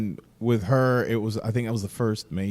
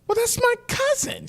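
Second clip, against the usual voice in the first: squeaky high voice. Retold or imitated. Retelling own reply.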